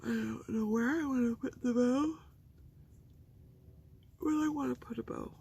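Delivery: mock-fret over where the bow goes; playful dithering. Real, not acted.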